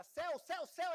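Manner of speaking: high, rapid-fire trader cry; mock panic. Traders passing on a garbled rumour, mock panic. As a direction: fire off the repeated word in mock desperation. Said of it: "todo mundo entrou em desespero".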